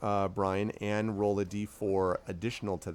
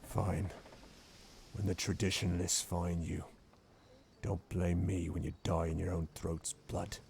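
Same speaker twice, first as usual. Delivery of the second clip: softer low growled threat; a lightly growled parting threat; quiet growl; 3 semitones lower, 11 dB quieter than usual. Acted. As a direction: spit the warning as he leaves. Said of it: "he kind of growls and he says".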